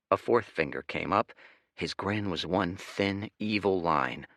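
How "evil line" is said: ordinary delivery, nothing marked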